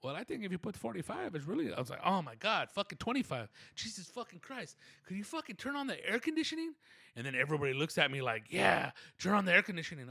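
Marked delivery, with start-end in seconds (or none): 2.1-6.7 rising higher into a mock outburst; exasperated, climbing to a pitched whine; retold exasperation. Sweating customer complaining to the tax lady, mock exasperated. 8.5-10.1 higher, emphatic echo of the demand; a room of people backing him up; chorus agreeing. Retold or imitated. Everybody in the office echoing the request, emphatic.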